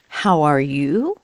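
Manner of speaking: How are you? with a slow rising tune on you; rising question tune. Shown as a demonstration. Teacher demonstrating rising intonation slowly. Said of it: "Your intonation goes up".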